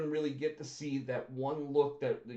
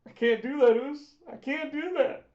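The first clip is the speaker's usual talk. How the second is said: imitating Jay; 12 semitones higher, 7 dB louder than usual. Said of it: "he's like".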